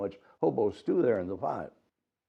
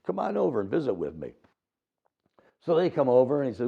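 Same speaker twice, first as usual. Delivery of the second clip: ordinary delivery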